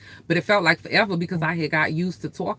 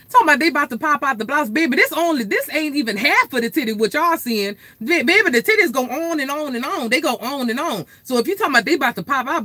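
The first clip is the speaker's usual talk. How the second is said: hyped rant; 7 semitones higher than usual. Real, not acted. Mocking commenters, hyped rant.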